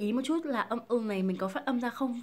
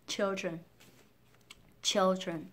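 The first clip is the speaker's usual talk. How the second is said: put-on "children" stressed on "chil", L sounded; first-syllable stress; 2 semitones lower, much slower than usual. Shown as a demonstration. Teacher showing main stress, "children" weighted at the start. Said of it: "sẽ nhấn mạnh vào".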